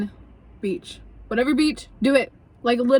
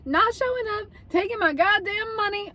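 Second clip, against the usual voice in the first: high, raised angry rant; mock fury at the no-show Uber driver; pissed rant; 10 semitones higher than usual. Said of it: "I'm like".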